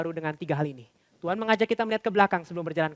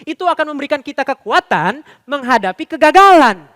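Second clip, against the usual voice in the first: loud, driving pulpit preaching; preaching at full force; 6 semitones higher, 12 dB louder than usual. Acted. Pastor to congregation, about failed resolutions, preaching full force. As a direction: drive it home to the congregation, louder.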